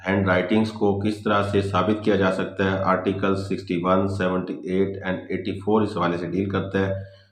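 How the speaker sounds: ordinary delivery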